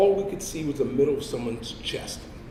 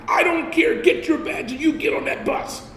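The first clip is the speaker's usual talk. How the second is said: sergeant yelling; 12 semitones higher than usual. Retold or imitated. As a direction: scream the order.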